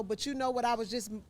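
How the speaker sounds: ordinary talk, nothing marked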